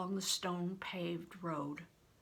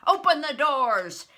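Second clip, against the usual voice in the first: loud, higher angry command; said angrily; 7 semitones higher, 13 dB louder than usual. A narrator's reading. Steward angrily ordering the soldiers to open doors. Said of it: "he said angrily".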